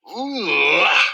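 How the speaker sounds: high strained ugh of disgust; disgusted gasp. A narrator's reading. Dentist inside the fox's mouth, disgusted gasp. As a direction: gag at the bad breath. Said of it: "he gasped".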